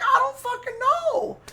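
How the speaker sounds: very high squawked protest; hammed-up high-pitched denial; imitating Quincy. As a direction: put on a strained mock falsetto. Retold Quincy yelling back.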